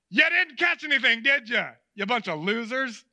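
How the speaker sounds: loud, jeering parody; mock taunt. Imagined Jesus mocking the empty-handed fishermen, jeering. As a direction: rub it in like a heckler. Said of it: "that's not how he talks".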